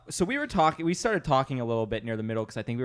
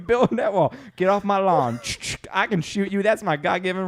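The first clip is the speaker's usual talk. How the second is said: higher, louder, hammed-up grumpy patriot; 6 semitones higher, 4 dB louder than usual. Mocking the grandma, hammed-up higher louder rant. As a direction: bellow it like a cartoon lawn defender.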